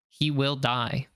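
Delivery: usual speaking voice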